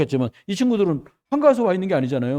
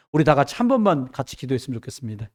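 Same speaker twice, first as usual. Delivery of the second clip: usual speaking voice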